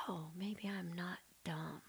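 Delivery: slow, hushed whisper of a small realization; a soft unvoiced whisper, slowed down; timid whisper. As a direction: breathe it out as a shy hopeful thought. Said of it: "I remember being like".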